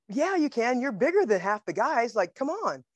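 high-pitched, insistent encouragement; acted-out pep talk from pals; urging friends. Friends urge her to try out.

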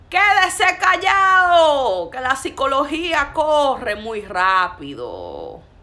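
loud, drawn-out preacher's shout falling from high; scolding shout. Real, not acted. Preacher silencing believers crying over vague words, scolding shout. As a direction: shout down the gullible weeper, slowly.

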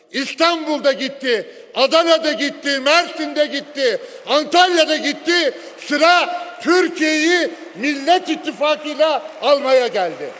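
rising to a rally cry; building shout. Real, not acted. (coşkuyla bağırarak)

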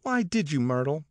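high, pressing question; demanding. A narrator's reading. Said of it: "demanded Catherine".

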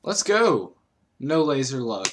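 high excited cheer. Real, not acted. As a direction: cheer loudly for the shot. Celebrates again.

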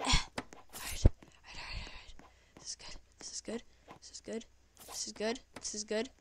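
breathy whisper talking himself down; whispered calm-down. Real, not acted. Gamer whispering to himself to stay calm, soft whisper. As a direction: hush yourself to stay focused.

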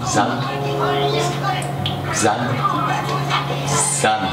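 ordinary talk

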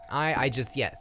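ordinary delivery

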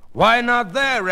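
loud, high-pitched comeback; defensive retort. Acted. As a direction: argue back, indignant. Husband argues back defensively.

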